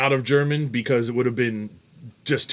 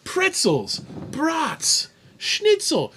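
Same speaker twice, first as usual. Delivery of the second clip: incredulous listing; much slower than usual. Real, not acted. Listing expected German foods, indignant.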